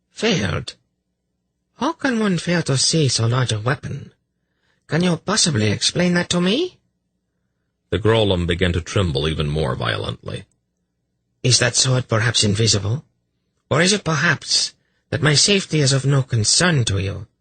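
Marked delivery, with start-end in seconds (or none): 0.1-6.8 high-pitched incredulous questioning; scornful priestess. A narrator's reading. Voices the priestess berating priest. 11.4-17.3 higher voice, pointed questioning; sarcastic priestess. A narrator's reading.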